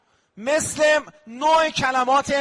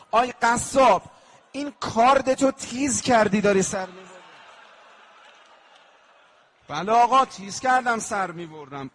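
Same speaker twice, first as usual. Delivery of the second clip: rising lament to the butcher; lamenting address; 2 semitones higher, 4 dB louder than usual. Acted. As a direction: call out to the butcher in grief. Voicing questions to the butcher.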